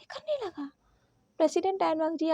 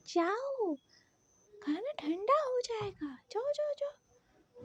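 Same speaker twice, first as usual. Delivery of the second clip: softer, slow whispered shooing; urgent whisper; 6 semitones higher, 7 dB quieter, much slower than usual. Acted. Wing shooing the president off to eat, whispering. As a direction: whisper him away, go go go.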